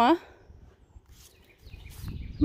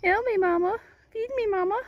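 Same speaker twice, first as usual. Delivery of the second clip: lightly voiced baby animal begging its mother; a higher little plea as the baby; voicing the baby; 6 semitones higher, faster than usual.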